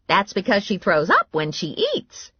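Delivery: high grandma voice; blunt grandma. A narrator's reading. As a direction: butt in like an outspoken granny.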